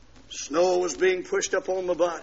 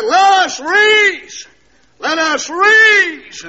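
slow, high, loud preaching cry; hollered pulpit text; 12 semitones higher, 11 dB louder, much slower than usual. Retold or imitated. Preacher repeating his verse to the congregation, hollering. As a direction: stretch it out and ring it from the pulpit.

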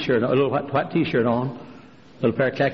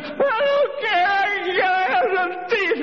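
put-on high, slow stubborn old woman; high drawn-out insistence; old lady insisting. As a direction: drag it out like a desperate old lady.

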